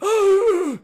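loud, high theatrical gasp; hammed-up scandalized intake; mock shocked gasp. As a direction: gasp in exaggerated horror. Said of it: "And I went".

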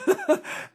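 quick burst of real laughter; a bright laugh that jumps up high; short laugh. Real, not acted. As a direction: laugh at your own point. Laughs after his own point.

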